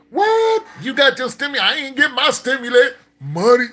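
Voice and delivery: high-pitched, loud outburst; loud astonishment. Real, not acted. Hearing someone got stimulus money, loudly astonished. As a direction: exclaim it high and incredulous.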